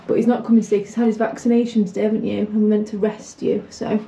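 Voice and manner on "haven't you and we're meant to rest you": ordinary talk, nothing marked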